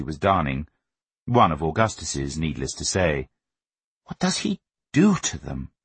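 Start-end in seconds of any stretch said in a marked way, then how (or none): 4.0-5.7 high, baffled mother's voice; puzzled. A narrator's reading. Mother wondering over her son's socks, puzzled. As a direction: wonder aloud in bewilderment, pitched up. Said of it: "she frequently puzzled".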